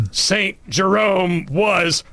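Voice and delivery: hammed-up verdict with a hugely drawn-out last word; Missus Champion. Speaker channelling a stern teacher against Saint Jerome, mock imperious rebuke. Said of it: "invoke the spirit of Missus Champion".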